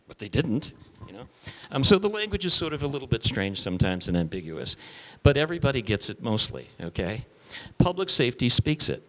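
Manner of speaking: normal talking voice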